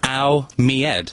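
slow English dialect, no H on "head". Shown as a demonstration. Guest repeats for puzzled host, slow English dialect. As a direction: slowly drop the H of "head", English-style. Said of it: "Or you would say".

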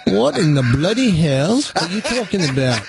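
hammed-up British accent; put-on British accent. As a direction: play the indignant Brit in a mock accent. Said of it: "my accent that I'm trying to do".

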